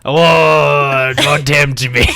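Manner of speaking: a laughing half-pirate growl; pirate-ish woodsman. Acted. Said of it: "You're starting to turn into a pirate".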